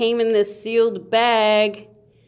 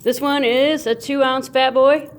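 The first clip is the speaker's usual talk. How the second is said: normal talking voice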